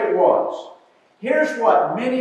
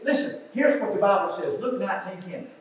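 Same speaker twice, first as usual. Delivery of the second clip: normal talking voice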